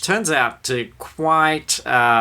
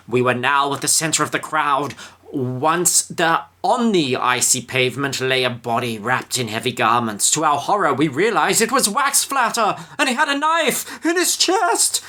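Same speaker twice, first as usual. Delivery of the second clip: rising higher and higher, panicky storekeeper; 4 semitones higher than usual. Retold or imitated. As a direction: build from calm to frantic squeal. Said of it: "the storekeeper was explaining".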